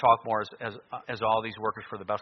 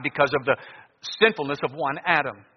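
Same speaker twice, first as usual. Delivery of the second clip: ordinary talk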